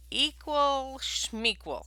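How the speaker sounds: dismissive bear. Acted. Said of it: "said Bear".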